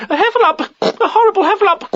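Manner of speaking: very high, squeaky alarm; panicked cry. A narrator's reading. Piglet looking down into trap, panicked cry. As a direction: squeal it out in terror. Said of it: "cried Piglet".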